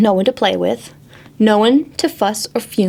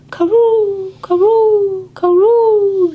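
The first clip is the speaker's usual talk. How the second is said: pigeon snore; 9 semitones higher, slower than usual. Acted. Reading to children, pigeons snoring in a high coo. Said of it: "that's how pigeons snore".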